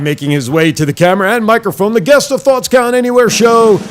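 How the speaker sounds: booming ring announcer intro. Pretend. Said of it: "how would Ricardo Rodriguez introduce Ricardo Rodriguez".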